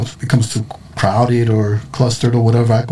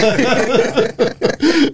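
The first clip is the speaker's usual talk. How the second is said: high-pitched laughing burst; 11 semitones higher than usual. Real, not acted.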